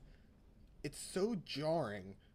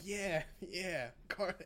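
raised-pitch mock excited narrator; very excited man; 6 semitones higher, much slower than usual. Podcaster imitating the English dub narrator, mock excitement. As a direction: lightly imitate an over-excited announcer. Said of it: "a very excited man".

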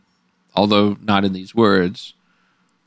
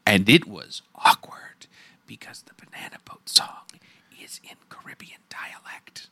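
softer conspiratorial whisper; 4 semitones lower, 9 dB quieter than usual. Real, not acted. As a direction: whisper it like an embarrassed aside. Awkward aside about the song.

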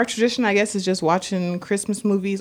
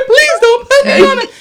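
put-on much higher, louder tearful kid voice; imitating pleading child; 8 dB louder than usual. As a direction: beg like a scared little boy. Imitating crying son pleading.

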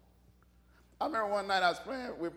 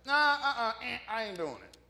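loud, playful refusal, easing off; mock refusal; 4 dB louder than usual. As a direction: balk at the whole idea, hammed-up. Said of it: "I would have been like".

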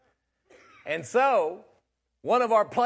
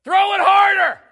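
loud high taunting yell; taunting shout; 15 dB louder than usual. Retold or imitated. Player heckling the pitcher from the dugout. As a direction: heckle him hard.

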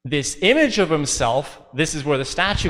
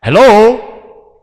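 loud, higher call through the door; hollering; 6 semitones higher, 12 dB louder than usual. Retold or imitated. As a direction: holler to get someone's attention. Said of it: "holler through the crack underneath the door".

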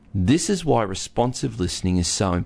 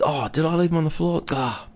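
higher-pitched feigned realization, quickly said; mock surprise; 7 semitones higher, faster than usual. Husband to wife over socks left out, mock surprised. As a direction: act out an oblivious husband's "oops".

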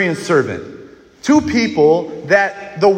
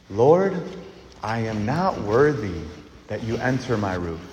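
humble plea; 8 semitones lower, 5 dB quieter than usual. Acted. Centurion humbles himself.

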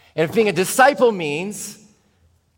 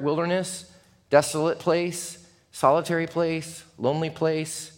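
ordinary talk

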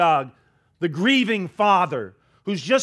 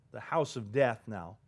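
hushed grim; 8 semitones lower, 11 dB quieter than usual. A narrator's reading. Grim naming of the house.

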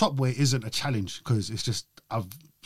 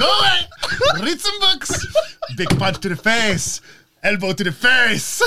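high, loud, slow mock outburst; hammed-up wrestler yelling, laughing through it; Nacho; 10 semitones higher, 6 dB louder, slower than usual.

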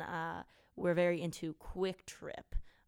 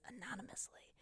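hushed, whispery quote from the form; soft and breathy, under the voice; whispered aside; 5 semitones higher, 15 dB quieter than usual. Retold or imitated. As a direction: whisper it like small print. Quoting the form's fine print.

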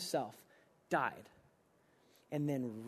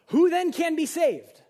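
astonished cry; 11 semitones higher, 10 dB louder than usual. Acted. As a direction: cry out in disbelief like the stunned disciples. Reads astonished disciples' cry.

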